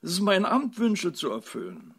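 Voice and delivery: plaintive, starting high and loud; near tears. A narrator's reading. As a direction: protest as if close to tears. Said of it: "rief die Fee den Tränen nahe".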